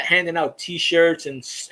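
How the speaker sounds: ordinary talk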